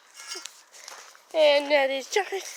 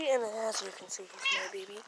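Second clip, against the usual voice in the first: tender cooing; 7 semitones lower, 10 dB quieter, faster than usual. Real, not acted. Cooing over the cat.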